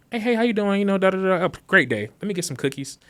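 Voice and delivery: high, chirpy mock-friendly hello; breezy cheerful greeting. Acts breezy greeting before handing resignation.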